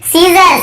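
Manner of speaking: सीजर drawn out with a plain S, no K; model pronunciation. Shown as a demonstration. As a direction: stretch सीजर slowly and clearly. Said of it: "यहाँ पर भी एस silent है".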